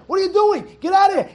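high, loud furious yell. Shown as a demonstration. Rabbi showing how to yell at the Yetzer Hara, loud. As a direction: yell at the tempter like a real person. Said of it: "Yell at him".

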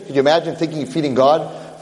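ordinary talk, nothing marked

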